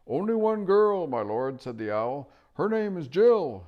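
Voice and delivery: high, loud hooting; loud owl. Acted. Owl shouting the girl's name to the deaf dwarf. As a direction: shout patiently at a deaf listener.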